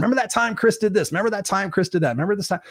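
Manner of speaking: put-on tossing out old stories like pals over beers; imitating old friends recalling his antics; mock mourners reminiscing. Mourners swapping stories at funeral.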